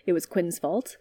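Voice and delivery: ordinary delivery, nothing marked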